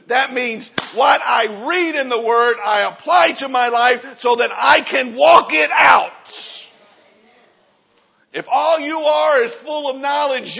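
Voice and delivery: loud, driving sermon build; full-force preaching. Real, not acted. Preacher urging the church to be strong, at full force. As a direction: preach it hard to the congregation.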